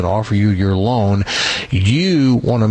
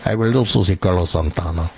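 lower-pitched mock Spanish accent; put-on Spanish accent; 4 semitones lower than usual. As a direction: answer in a playful Spanish accent. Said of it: "I'm talking the Spanish accent".

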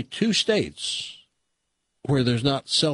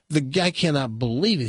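normal talking voice

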